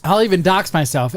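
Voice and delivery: ordinary talk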